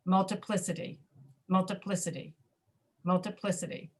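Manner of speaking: "multiplicity" as mul-tuh-PLIS-uh-tee, vowel before PLI swallowed; reduced vowel. Shown as a demonstration. Teacher asking students to listen, "multiplicity" with reduced vowels. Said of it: "Listen to how I'm saying it".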